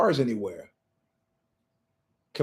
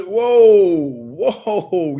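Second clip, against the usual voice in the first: dawning realization; 2 semitones higher, 7 dB louder than usual. Retold or imitated. Realizing he was tricked.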